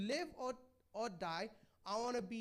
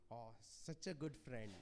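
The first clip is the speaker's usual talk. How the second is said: much lower, slow, dripping sarcasm; sarcastic thanks; 9 semitones lower, 7 dB quieter than usual. Retold or imitated. Preacher mocking how we answer a friend who corrects us, slow and sarcastic. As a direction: drawl it out like you mean the opposite. Mock reply to being corrected.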